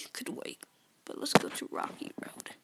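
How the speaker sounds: hushed whisper to himself. Real, not acted. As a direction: whisper it under your breath. Whispering while gaming.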